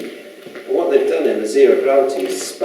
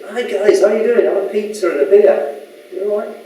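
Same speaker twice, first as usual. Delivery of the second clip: higher, louder matey hello to the guards; cheery greeting; 10 semitones higher, 5 dB louder than usual. Acted. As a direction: greet them like old pals down the pub. Greeting border guards in scenario.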